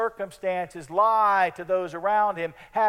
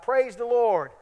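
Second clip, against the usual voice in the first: mock shout; 6 semitones higher, 3 dB louder than usual. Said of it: "stand on her front porch and shout".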